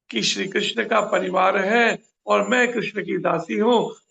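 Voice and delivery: ordinary talk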